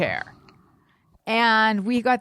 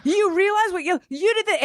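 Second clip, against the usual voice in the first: red-faced dad; 10 semitones higher than usual. Retold or imitated. Dad confronting a stranger, high and furious. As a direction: confront someone the way dad did. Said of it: "red-faced and just going up".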